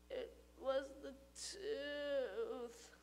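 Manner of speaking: very soft, slow, drawn-out; weary confession. Acted. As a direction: groan it out, defeated. Sick boy confessing.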